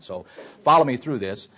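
usual speaking voice

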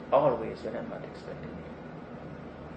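hushed repeat. Shown as a demonstration. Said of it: "let me emphasize this".

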